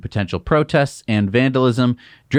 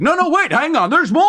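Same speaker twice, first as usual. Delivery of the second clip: high-pitched, eager cry to hold on; urgent interjection; 3 dB louder than usual. Acted. Host imagining stopping departing listeners, urgent interjection. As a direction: burst in with a high, insistent plea. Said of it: "I'd be like".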